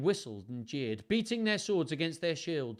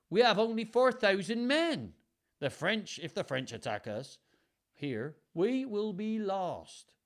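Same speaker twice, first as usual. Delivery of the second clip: higher, urgent plea of an anxious duke; worried old duke; 9 semitones higher, 6 dB louder than usual. Acted. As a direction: warn the king, fearful. Worried Duke warns the king.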